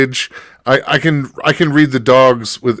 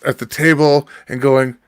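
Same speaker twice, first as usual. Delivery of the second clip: normal talking voice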